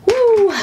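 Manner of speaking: a loud high whoop; high loud woo. Real, not acted. Host cheering at the episode's end, high loud whoop. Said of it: "celebrating end of show".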